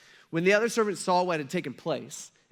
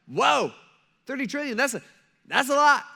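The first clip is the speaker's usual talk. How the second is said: high, loud, wowed reaction; stunned amazement; 8 semitones higher, 7 dB louder than usual. Acted. Crowd hearing Jesus's story, stunned amazement. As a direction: react floored by a huge number. Crowd hears a huge sum.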